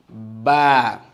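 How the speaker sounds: ba said loud and crisp; teacher demo ba. Shown as a demonstration. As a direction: model ba for the class to repeat. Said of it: "say it after me".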